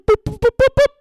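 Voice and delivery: high, loud electronic boops; mock computer beeping; robot beeps. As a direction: mimic a machine rejecting you. Imitating a robot scanner.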